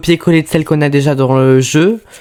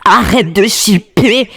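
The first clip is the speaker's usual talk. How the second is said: higher cartoon kid voice, drawn out; kids' show catchphrase, higher and sing-song; Dora the Explorer; 6 semitones higher, slower than usual. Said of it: "épisode de Dora l'exploratrice".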